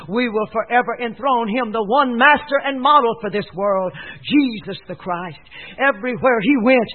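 ordinary talk

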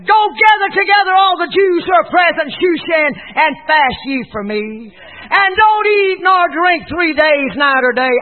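high, shouted preacher's decree; loud, stretched-out dramatic preaching. Acted. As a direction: proclaim Esther's command at full force. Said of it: "Esther responded so dramatically".

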